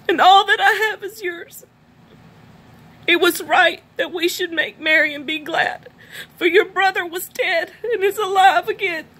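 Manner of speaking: reading the father's words through tears; crying while reading. Real, not acted. Reading the prodigal son's father aloud, crying. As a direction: voice breaking with real tears, pitched high. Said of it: "I'm sorry that I cry so much".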